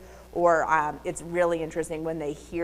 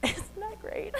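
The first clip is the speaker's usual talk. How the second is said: laughing; 7 dB quieter than usual. Real, not acted. Laughing at chickens dust bathing.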